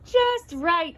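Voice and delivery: ordinary delivery, nothing marked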